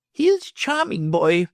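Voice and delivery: high, gushing proud mother; doting exclamation. A narrator's reading. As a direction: gush over her son. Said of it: "my lady exclaimed".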